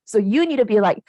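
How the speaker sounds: usual speaking voice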